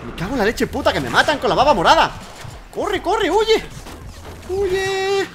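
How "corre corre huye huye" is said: high-pitched panicked urging; urgent high wail to flee; mock panic. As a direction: cry it out high, stretching the word. Fleeing enemies in game.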